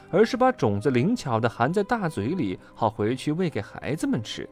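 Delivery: ordinary delivery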